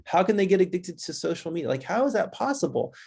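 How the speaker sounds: mildly mock a baffled parent; disbelieving parents. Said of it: "some parents are like".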